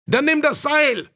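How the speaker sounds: very high-pitched call, no extra volume. A narrator's reading. Another spectator answers the heckler, high calling voice. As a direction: shout advice across a crowd in a high voice. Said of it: "rief ein anderer".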